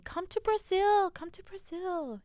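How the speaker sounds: high, slow pleading fan chant; fan comment meme voice. Retold or imitated. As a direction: beg like an online fan. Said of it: "that meme where it's like".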